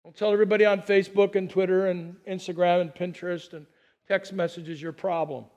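ordinary talk, nothing marked

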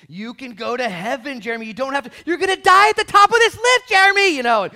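loud, high urgent plea; urgent shout. Retold or imitated. Preacher shouting at Jeremy on the lift to be born again. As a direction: shout it at him like his life depends on it.

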